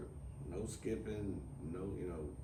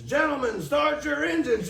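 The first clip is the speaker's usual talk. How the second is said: much higher and louder booming announcer call; race announcer; 12 dB louder than usual. Pretend. Joking about his friend at NASCAR races, booming announcer call. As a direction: belt it out like the NASCAR starter.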